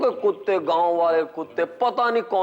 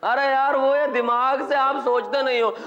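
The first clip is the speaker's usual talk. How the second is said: samosa seller; 7 semitones higher than usual. Acted. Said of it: "समोसे बेचने वाला".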